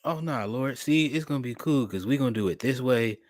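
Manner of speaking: ordinary talk, nothing marked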